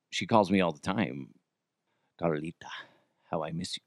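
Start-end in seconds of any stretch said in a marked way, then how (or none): 2.2-3.8 low, soft, pretend lovesick murmur; hushed mock-romantic aside; mock longing. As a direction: sigh it quietly like a hammed-up pining lover. Mock longing for lost lover.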